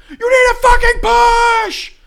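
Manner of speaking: loud slow high shouting at teammates; raging yell. Retold or imitated. Keith raging at teammates during capture the flag, shouting. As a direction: scream it mid-match. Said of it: "he's just like".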